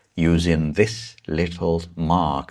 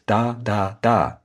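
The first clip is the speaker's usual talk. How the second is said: "da, da, da" in equal, heavy beats; every beat stressed; 4 semitones higher than usual. Shown as a demonstration. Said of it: "Don't emphasize every word like this".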